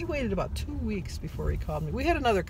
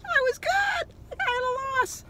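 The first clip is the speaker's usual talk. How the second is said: gleeful cheer; 4 dB louder than usual. Real, not acted. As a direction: crow it like a proud schoolkid. Gleeful gold-star boast.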